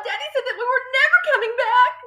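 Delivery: put-on high, hurried child's wail; upset girl, fast and high-pitched; despairing cry. A narrator's reading. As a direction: blurt it out in distress. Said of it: "Matilda cried".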